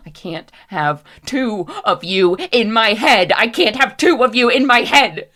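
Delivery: building from loud to a high, frantic yell; frantic shouting. Acted. Character barely holding on, building to desperate screaming. Character losing control, overwhelmed.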